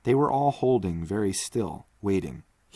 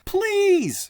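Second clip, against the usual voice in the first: much higher and louder desperate cry; shouting; 8 dB louder than usual. Acted. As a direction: beg at the door with all his voice. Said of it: "he shouted".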